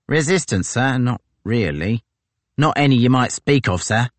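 a gloating sergeant pleased with himself; brash marine sergeant. Acted. Tozer to his captain, brash and gloating.

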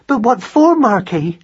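high, distressed question; tremulous, worried mouse mother; shaking voice. A narrator's reading. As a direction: ask it fearfully, voice pitched right up. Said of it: "said Madeline in a shaking voice".

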